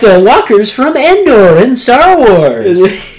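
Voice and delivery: high, loud, drawn-out gushing; hammed-up wide-eyed kid; mock awe. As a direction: marvel at it in an exaggerated high voice. Mocking Peter's wide-eyed enthusiasm.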